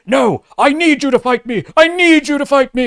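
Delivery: high, hammed-up dramatic plea; mock Hook pleading. Reviewer mocking Hook's cringe line, high hammy plea. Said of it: "line where Hook is like".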